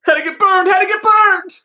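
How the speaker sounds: high, loud yelps of pain from a burn. Real, not acted. Lighting the candle and burning his fingers, pained high yelps. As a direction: yelp it out like you just singed your fingers.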